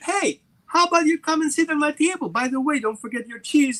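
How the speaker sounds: dog inviting its owner to sit and share the bone; friendly dog. Retold or imitated. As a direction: invite them over cheerfully.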